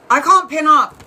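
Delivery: fed up with the pinning not working; real upset at the glitch; real frustration. Real, not acted. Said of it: "I'm getting really upset".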